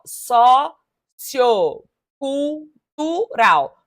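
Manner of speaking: so-ci-o-cul-tu-ral, each syllable drawn out very slowly; split into syllables. Shown as a demonstration. Teacher counting syllables for the class, very slow and spaced. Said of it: "Então, vai ser, ó".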